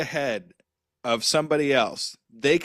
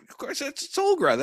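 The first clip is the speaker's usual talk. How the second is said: put-on very high, childlike matter-of-fact; little kid insisting; 14 semitones higher than usual. Child telling dad the Dark Crystal character, insisting. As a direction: play your younger self, obviously right.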